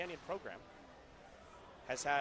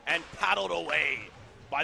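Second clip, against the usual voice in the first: loud, punched play-by-play call; excited call; 8 dB louder than usual. Real, not acted. Commentator calling a shot paddled away, excited call. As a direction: belt out the save.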